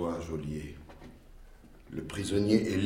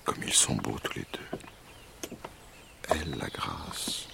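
soft, tender whispered aside; whispering; 5 semitones lower, 8 dB quieter than usual. Acted. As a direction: murmur it to yourself. King murmurs aside watching couple.

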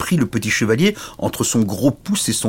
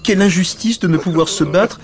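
high, loud wailing lament; hammed-up weepy complaint; tearful little knight; 11 semitones higher, 6 dB louder than usual. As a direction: bemoan the injustice, pitched up. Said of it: "le petit chevalier se mit à pleurer".

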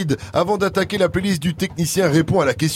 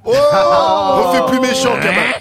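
higher, louder, slow shout; 7 semitones higher, 5 dB louder, slower than usual. Real, not acted. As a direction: call it out big and slow. Host eggs on the villain.

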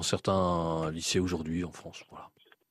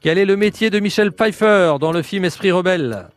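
game show host; 8 semitones higher, 4 dB louder than usual. Acted. Quiz host question.

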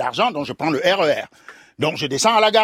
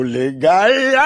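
dramatic proclamation; 4 dB louder, slower than usual. Acted. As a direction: swell up and stretch out the word. Dramatic punchline of the news.

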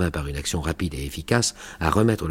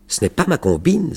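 much higher and louder, furious; furious outcry; 10 semitones higher, 5 dB louder than usual. A narrator's reading. Taher, furious at Karim's refusal, cries out angrily. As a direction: fling it back in anger. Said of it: "s'écria Taher, furieux".

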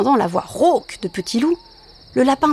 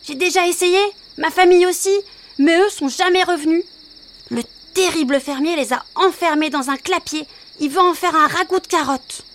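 higher, worried little rabbit; a fretful bunny, pitched up; 7 semitones higher than usual. Acted. Rabbit voiced.

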